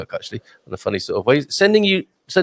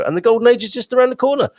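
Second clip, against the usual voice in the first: excited announcement; 11 semitones higher, faster than usual. Acted. Grand announcement of golden age.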